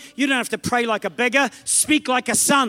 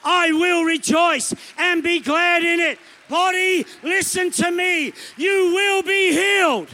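slow, higher bold declaration; declaring; 7 semitones higher, slower than usual. Shown as a demonstration. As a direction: proclaim it over your body, slowly. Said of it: "You need to get up and go".

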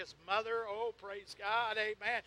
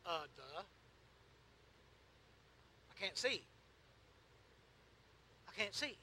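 low, halting mock duh; lightly acted blind man's reply; acted obvious answer; 3 semitones lower, 9 dB quieter than usual. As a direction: state the obvious with pauses, softly. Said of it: "a little Pastor Blacker paraphrase".

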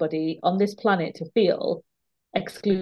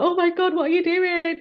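high-pitched mock alarm, much higher; retold inner panic; 10 semitones higher than usual. Facing the burly movers, her inner panicked voice. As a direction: squeak it out like your heart is racing.

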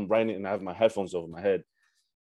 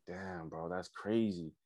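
much softer, lower murmur of awe; stunned hush; 4 semitones lower, 12 dB quieter, slower than usual. Retold or imitated. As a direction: say it under your breath, amazed. Stunned by [name] message.

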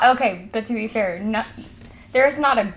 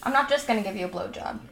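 normal talking voice

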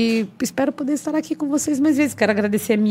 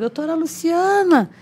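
slow, higher drawn-out call; imitated calling out; 6 semitones higher than usual. Team calls her to the balcony, drawn-out calling. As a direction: mimic someone summoning her from the street. Equipe chamando da rua para a sacada.

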